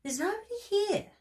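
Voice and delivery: high, small bear cub voice; Baby Bear voice. Acted. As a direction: squeak it out like a little bear cub.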